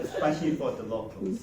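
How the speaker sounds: ordinary talk, nothing marked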